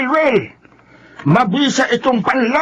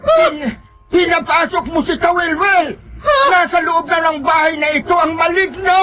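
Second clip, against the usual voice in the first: urgent raised shout; alarmed shout; 6 semitones higher than usual. Acted. Brother warning that the monster is inside, shouting. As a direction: cry the alarm.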